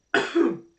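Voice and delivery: loud throaty hawk; mock spitting. Guard spitting near the prisoner, loud mock hawking. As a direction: hawk it up as if to spit. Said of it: "like, spits".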